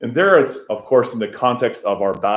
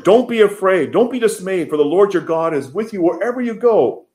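building from loud to full preaching force, higher and louder; rising exhortation; 9 semitones higher, 7 dB louder than usual. Acted. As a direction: shout the promise out like a charge to Joshua.

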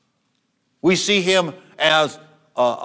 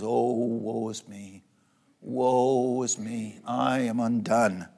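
slow lament; 5 semitones lower, 8 dB quieter, slower than usual. Acted. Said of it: "and he says".

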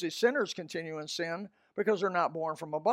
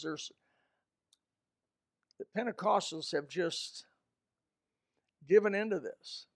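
normal talking voice

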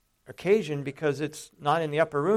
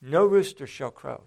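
raised, louder call on the name, then firm; urgent; 2 semitones higher, 7 dB louder than usual. Retold or imitated. Jesus responds to Peter's declaration, more urgent. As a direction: press the warning home with urgency. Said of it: "a little more urgency in Jesus' response".

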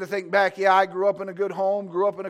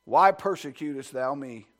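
voice from heaven; 5 semitones lower, 4 dB louder, slower than usual. Acted. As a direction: call his name from the sky. Voice from heaven opens sermon.

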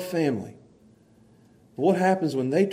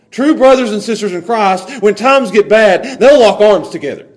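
louder, rising preacher's call; building preaching; 6 semitones higher, 10 dB louder than usual. Acted. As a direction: swell the sermon line up with conviction.

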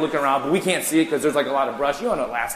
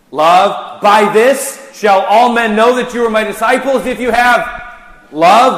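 much higher and louder, soaring on love; preaching at full force; 8 semitones higher, 11 dB louder, slower than usual. Real, not acted. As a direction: lift the crowd with the climax.

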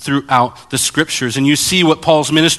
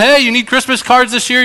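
pushy shop vendor; 10 semitones higher than usual. Retold or imitated. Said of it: "the guy's out front going".

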